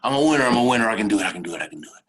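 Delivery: loud burst fading to a low murmur; rapid mantra, strong start, trailing down; pep talk. Retold or imitated. Luger psyching himself up on track, loud chanted pep talk. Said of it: "give myself a pep talk".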